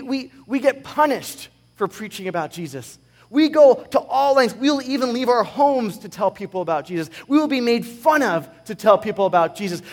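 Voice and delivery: loud, impassioned sermon, driving each line; full-force preaching. Acted. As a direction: preach it hard to the congregation.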